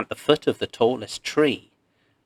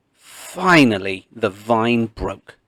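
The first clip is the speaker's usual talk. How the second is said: plain reading voice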